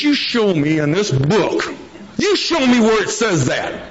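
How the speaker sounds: mock-stern sponsor demanding proof; sponsor's stern challenge. Sponsor confronting him over his statement.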